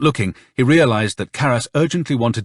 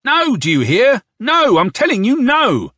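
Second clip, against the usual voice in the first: high, loud, insistent refusal; exasperated shouting at raised pitch; 6 dB louder than usual. A narrator's reading. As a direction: shout no, drawing it out. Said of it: "came a shout".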